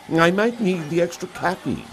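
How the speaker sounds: a casual customer justifying his choice; tea drinker voice. Acted. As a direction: narrator lightly voicing the tea drinker. Tea drinker's quoted line.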